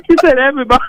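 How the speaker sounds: talking high through real laughter; can barely get the words out for laughing. Real, not acted. Guest retells a caller's answer, in helpless laughter.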